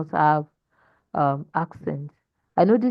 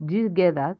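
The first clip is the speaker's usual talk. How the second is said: ordinary talk, nothing marked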